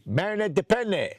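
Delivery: lightly voiced French accent asking the name; French official. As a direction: ask the name like a French officer, half-hearted. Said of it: "and you".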